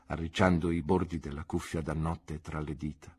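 ordinary talk, nothing marked

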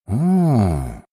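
long, drawled "ah" of realisation; drawn-out. A narrator's reading. Aristocrat hears the nephew explanation, drawls it out. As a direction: stretch the vowel out as it dawns. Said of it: "протянул Арлинг".

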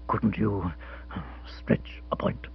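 hushed, breathy plea, nearly voiceless; silent plea. Acted. Captain Biggar silently begs his friends for leniency, whispering. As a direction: whisper it as a desperate appeal. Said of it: "he inquired silently".

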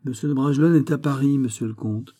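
ordinary delivery, nothing marked

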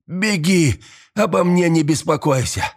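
high-pitched desperate urging; urgent shout. A narrator's reading. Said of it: "крикнул Туми".

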